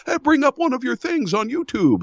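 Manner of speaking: high-pitched imitation of pestering friends; eager friends' request. Coffee shop friends asking him for a demo, high mimicking voice. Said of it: "But then they'll go".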